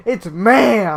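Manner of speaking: hammed-up indignant MA'AM; mock indignant correction. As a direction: blare the correction, mimicking outrage. Repeats mocked correction with force.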